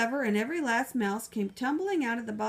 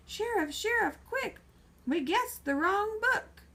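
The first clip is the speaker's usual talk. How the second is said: high, urgent librarian voice, slower at the end; librarian shouting in alarm; 10 semitones higher than usual. Acted. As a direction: call the sheriff in a panic, pitched up. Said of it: "come rushing up shouting".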